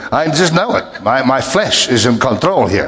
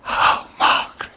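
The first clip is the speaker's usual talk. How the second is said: tempting whisper; 5 dB quieter than usual. Retold or imitated. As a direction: sigh the name longingly. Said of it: "the spirit of Satan would say".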